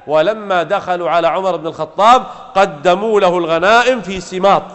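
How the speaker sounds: normal talking voice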